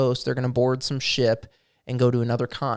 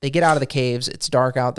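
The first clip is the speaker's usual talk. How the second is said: ordinary talk, nothing marked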